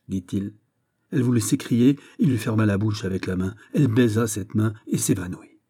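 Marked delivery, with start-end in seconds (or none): none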